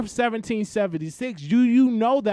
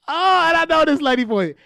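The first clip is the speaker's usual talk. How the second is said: laughing; 9 semitones higher than usual. Real, not acted. Host cracking up over caller.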